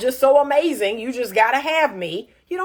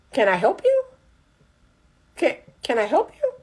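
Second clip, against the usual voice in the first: dry brush-off; 3 semitones higher than usual. Retold or imitated. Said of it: "what's the first thing I say".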